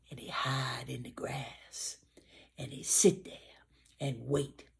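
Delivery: hushed sneaky whisper. A narrator's reading. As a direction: whisper it like hiding in the grass. Gator sneaking in the grass.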